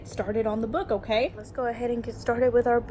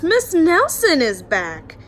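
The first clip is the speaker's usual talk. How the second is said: louder, slower happy shout-out; delighted announcement; 6 semitones higher, 8 dB louder, slower than usual. Acted. The class thrilled that their teacher is back, louder and slow. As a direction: announce it with glee. Class sees Miss Nelson return.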